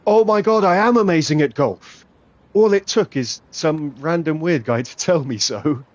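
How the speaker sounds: loud, high burst of triumph; exultant yell, crowing over the shot; ecstatic boasting. Real, not acted. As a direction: shout it out in delighted amazement. Celebrating a great shot.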